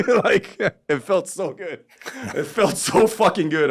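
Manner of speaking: high, slow, laughing relish; laughing delight. Real, not acted. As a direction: savor it through real laughter, drawn out.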